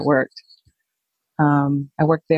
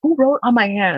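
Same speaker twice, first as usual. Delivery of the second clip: upset; 6 semitones higher, slower than usual. Retold or imitated. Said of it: "And I got upset".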